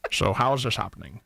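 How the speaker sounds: low Scottish actor's puzzled question; Sean Connery. Shown as a demonstration. Late actor puzzled at speaking, low Scottish impression. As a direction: ask it in a deep Scottish burr. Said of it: "I'm Sean Connery".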